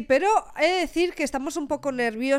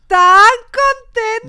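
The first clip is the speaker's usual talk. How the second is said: excited; 11 semitones higher, 11 dB louder, much slower than usual. Real, not acted. Excited about sponsor.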